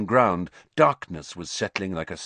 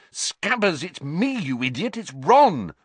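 hissed; 8 semitones higher, 4 dB louder than usual. A narrator's reading. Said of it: "Ron hissed".

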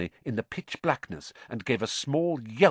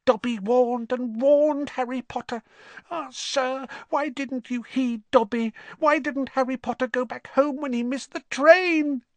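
high, squeaky elf voice, pleading; a fretting elf begging Harry to understand; squeaky elf, reproachful and worried; anxious house elf. Acted. Elf voice held.

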